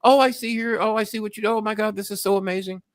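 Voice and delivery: a young operator gushing to a caller, voiced high and sweet; imitating sweet Filipino operator. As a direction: play the chirpy call-center girl. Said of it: "she's looking me up".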